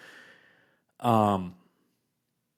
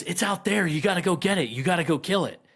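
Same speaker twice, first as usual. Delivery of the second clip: frantic pleading; 6 semitones higher than usual. Retold or imitated. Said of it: "And she goes".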